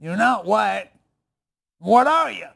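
slow, deliberate puzzling, building to a loud, high question; baffled local stranger. Retold or imitated.

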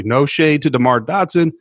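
ordinary talk, nothing marked

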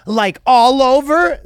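stretched high "Like, all over?"; high, slow mock question. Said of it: "And then she goes".

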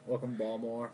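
casual Baltimore accent, syllables swallowed. Shown as a demonstration. Guest asked by host to show it, Baltimore accent. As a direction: say it like a Baltimore local. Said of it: "Can you do a Baltimore accent?".

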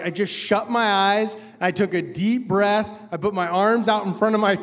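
normal talking voice

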